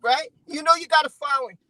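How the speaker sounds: ordinary talk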